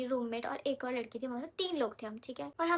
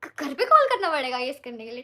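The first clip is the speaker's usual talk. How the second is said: shocked disbelief; 8 dB louder than usual. Retold or imitated. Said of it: "we were like".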